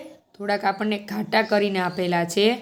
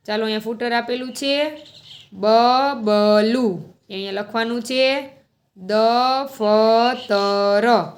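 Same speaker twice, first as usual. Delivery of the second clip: ordinary talk, nothing marked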